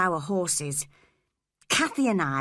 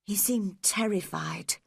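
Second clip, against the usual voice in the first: usual speaking voice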